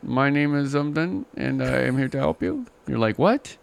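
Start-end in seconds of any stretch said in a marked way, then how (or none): 0.0-2.6 mock Indian agent. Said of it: "an Indian voice". 3.2-3.6 a high, rising what; baffled what. Retold or imitated. Caller confused by the agent's accent, high rising what.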